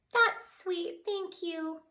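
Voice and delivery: put-on tiny fairy thanking a kind girl; softened fairy. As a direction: melt a little, small and high. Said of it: "changing her attitude".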